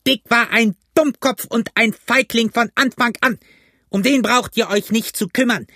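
high-pitched angry exhorting; agitated, cursing high voice; furious rant. A narrator's reading. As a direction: berate and drive on cowardly men. Pugh rants, curses, urges men on.

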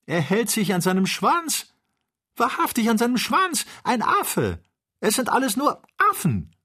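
very high-pitched, gleeful exclaiming; bursting with hilarity. A narrator's reading. Joe discovering they are only monkeys, bursting with hilarity. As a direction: squeal it as if bursting with amusement. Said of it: "wollte bersten vor Lachen".

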